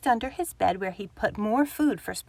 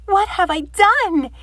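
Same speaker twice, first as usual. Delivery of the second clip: panicked; 9 semitones higher, 8 dB louder than usual. Acted. Badger realises in panic.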